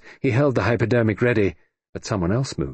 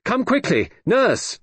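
calling for the nurse; 14 semitones higher, slower than usual. A narrator's reading. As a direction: call the nurse from the doorway, fast. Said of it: "was calling".

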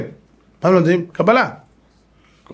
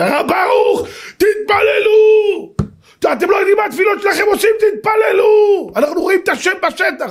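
urgent retold cry. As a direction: cry it out urgently, stretching the pleas. Officer excitedly urging rabbi by phone. (קריאה נרגשת וגבוהה של קצין)

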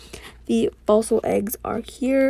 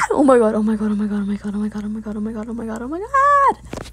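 overexcited babble; faster than usual. Real, not acted. Gamer overwhelmed by the new event, frantic excited babble. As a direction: chant it breathlessly, then squeal the last word.

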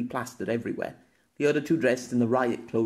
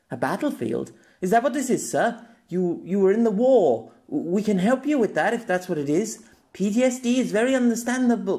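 much higher, anxious policeman; pleading officer; 9 semitones higher than usual. Acted. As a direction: plead with him, pitched high. Officer tries to calm Pierre.

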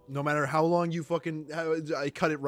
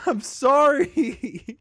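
slow, high, laugh-cracked sorry; laughing apology; 8 semitones higher, 8 dB louder, much slower than usual. Real, not acted. Apologizing to his friend through laughter. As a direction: apologize while still laughing hard.

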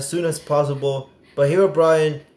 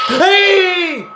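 loud, high shout at the monster; 7 dB louder than usual. Real, not acted. Gamer yelling at the chasing monster, loud high shout. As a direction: yell to scare it off.

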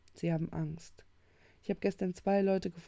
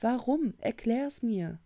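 high, raised demand; exclaiming; 5 semitones higher, 5 dB louder, slower than usual. A narrator's reading. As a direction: call out a challenge, slowing on the request. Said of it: "rief Jamie". (laut fordernder Ausruf)

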